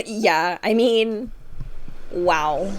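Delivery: ordinary delivery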